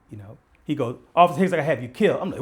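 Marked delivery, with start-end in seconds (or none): 1.2-2.2 put-on threatening kid. Drug dealer kid threatens the officer.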